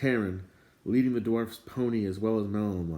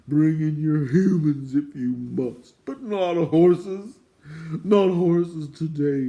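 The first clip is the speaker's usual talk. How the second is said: high, loud, slow wailing protest; a distraught doorkeeper begging the dwarf, high and slow; whining plea; 6 semitones higher, 9 dB louder, slower than usual. A narrator's reading. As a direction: wail and plead in dismay. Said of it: "he sobbed".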